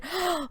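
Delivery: sharp high gasp of delight; Sarah gasping at news of a Pokemon; excited gasp. A narrator's reading. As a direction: gasp in wonder. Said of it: "Sarah gasped".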